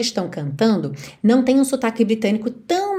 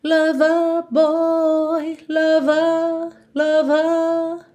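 sung, higher "Lover" with the final vowel lengthened, no R; British accent; 6 semitones higher, much slower than usual. Shown as a demonstration. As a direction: stretch the last vowel of "lover" British style. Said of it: "Já no inglês britânico, eu alongo esse E". (sotaque britânico cantado, vogal final alongada)

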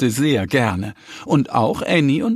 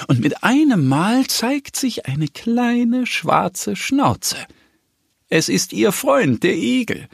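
much higher, very slow reveal; 10 semitones higher, slower than usual. A narrator's reading. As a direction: draw out the surprise. Reveal of the hedgehog.

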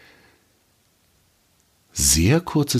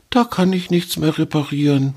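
sad; 9 semitones higher than usual. Acted. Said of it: "sagte er traurig".

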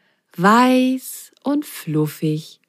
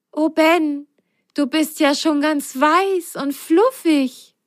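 higher, wide-eyed amazement; amazed; 9 semitones higher than usual. A narrator's reading. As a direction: marvel at how fluffy he looks. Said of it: "staunte Biene".

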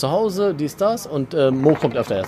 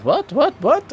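high-pitched, incredulous repeated question; shocked disbelief; 12 semitones higher, slower than usual. Retold or imitated. Patient retelling his reply to the dentist, shocked disbelief. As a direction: jump up high, as if stunned by the news. Patient told tooth must come out.

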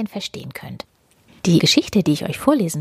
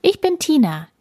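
friendly policewoman; 9 semitones higher than usual. Acted. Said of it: "sagt sie freundlich".

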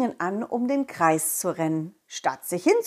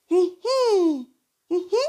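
giggly sneering imp; 10 semitones higher, 3 dB louder, slower than usual. Acted. Ball character giggles.

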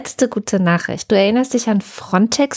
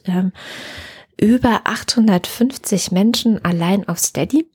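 ordinary talk

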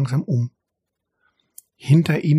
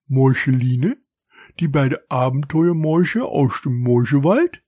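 surprised stranger mouse. A narrator's reading. Stranger mouse recognises the heroes.

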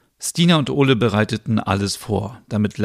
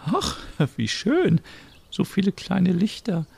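put-on very high, thin old-lady voice; old neighbour offering help, high and frail; elderly Merit; 9 semitones higher than usual. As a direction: play a sweet old neighbour.

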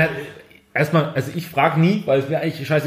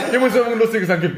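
excited; 6 semitones higher, much faster than usual. Retold or imitated. Repeat, higher and faster.

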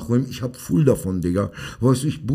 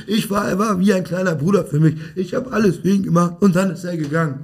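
higher-pitched mock lament; mock self-styled victim; 7 semitones higher than usual. Mocking people who recount what they did for others, higher-pitched mock complaint. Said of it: "dann erzählen sie immer, was sie für alle getan haben".

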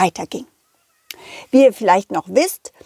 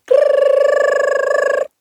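high rattling alarm-clock ring; alarm ring imitation. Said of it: "klingelte es wieder".

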